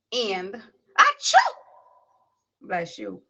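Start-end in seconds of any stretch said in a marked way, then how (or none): 1.0-1.4 sudden high sneeze; a big sharp sneeze; loud sneeze. Real, not acted.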